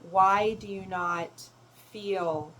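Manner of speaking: normal talking voice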